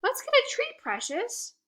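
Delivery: dog owner coaxing her retriever, very high; cooing to dog. Acted. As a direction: sweet-talk the dog.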